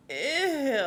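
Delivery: high, loud, drawn-out "ew"; hammed-up grossed-out reaction; disgusted. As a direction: recoil in exaggerated disgust. Reaction to awkward kiss.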